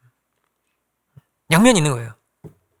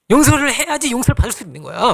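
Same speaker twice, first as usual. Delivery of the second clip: stern lecturing; 6 semitones higher than usual. Pretend. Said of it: "이럴 수도 있잖아요".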